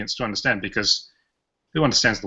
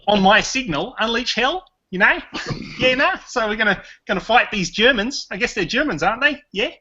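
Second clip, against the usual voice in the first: exaggerated Australian accent, pitched very high; high, comic mock-Australian gladiator; Russell Crowe parody; 10 semitones higher than usual. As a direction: ham up the Australian with questioning rises. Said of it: "he doesn't really talk like this".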